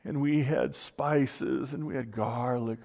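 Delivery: drawn-out nostalgic moaning, hammed-up; mock complaining. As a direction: imitate grumblers pining for old meals. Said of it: "they started complaining".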